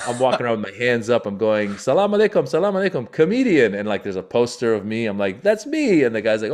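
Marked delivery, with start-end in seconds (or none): 1.8-3.7 high, loud, placating announcement; nervous greeting. Retold or imitated. Comic greeting soldiers with guns, high anxious announcement. Identifying himself to armed soldiers. 5.4-6.0 high, loud exclamation; eager self-identification. Retold or imitated. Comic showing soldiers his poster, high eager exclamation.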